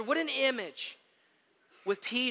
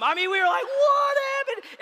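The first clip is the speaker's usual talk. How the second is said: excited shriek; 9 dB louder than usual. Real, not acted. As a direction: scream it out in shocked delight. Stunned by the jump.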